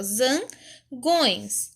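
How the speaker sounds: "zangões" very slow, as zan, long pause, gões; split into syllables. Shown as a demonstration. Teacher showing how the pupil separated the word, "zangões" in slow syllables. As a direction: break "zangões" in two with a clear gap. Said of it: "ela separou a palavra".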